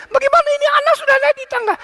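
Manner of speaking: high, fast, frantic cry; panicked shout. Retold or imitated. Old mother-in-law screaming as the toddler climbs. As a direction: shriek in panic at a child up a ladder. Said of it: "terus dia teriak".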